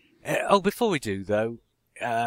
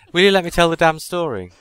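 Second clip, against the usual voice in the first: louder, higher, fed-up protest; exasperated outburst; 6 semitones higher, 7 dB louder, faster than usual. Acted. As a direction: demand the floor from your interrupting co-hosts. Interrupted storyteller snaps at co-hosts.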